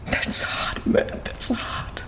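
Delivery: whispered to himself in grief; broken whisper. Real, not acted. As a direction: breathe the words out, spent. Overwhelmed, drops to near silence.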